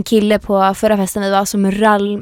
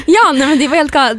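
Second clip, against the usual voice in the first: laughing; 6 semitones higher, 3 dB louder than usual. Real, not acted. Laughing at the story.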